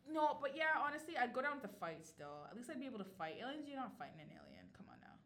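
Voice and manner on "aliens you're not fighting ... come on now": hushed, half-whispered insistence. Real, not acted. To her co-host, whispering that nobody can fight aliens. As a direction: whisper it like an obvious secret.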